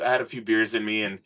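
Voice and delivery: normal talking voice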